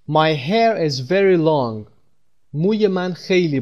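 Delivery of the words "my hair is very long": slow model sentence. Shown as a demonstration. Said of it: "مو یک کلمهٔ مفرده".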